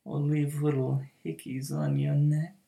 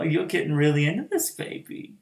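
ordinary delivery

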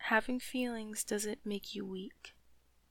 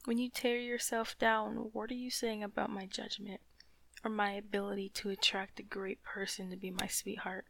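usual speaking voice

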